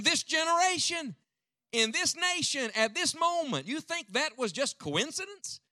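high, urgent pulpit proclamation; impassioned preaching. Real, not acted. Preacher urging the congregation about living at this moment, impassioned. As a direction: preach it high, drawing out the key phrases.

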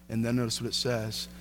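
normal talking voice

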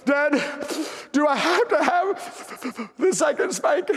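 put-on high, slow, gasping child sobs; mock sobbing. Daughter asking dad about second spanking, imitated sobbing. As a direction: choke out words between imitated sobs. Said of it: "You can't even talk".

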